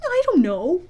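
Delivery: high-pitched, wondering voice; awestruck. A narrator's reading. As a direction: answer in sheer wonder.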